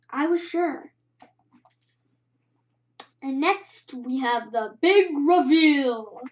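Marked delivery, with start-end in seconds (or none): 3.2-6.2 slow, drawn-out build to a reveal; dramatic announcement. Acted. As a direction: announce it slowly, stretching the big moment. Announces the big reveal, playfully.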